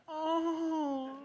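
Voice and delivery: very high sympathetic coo; touched "oh". Retold or imitated. Press people moved by his tears, high coo. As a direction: coo like you're melting. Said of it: "everyone would go".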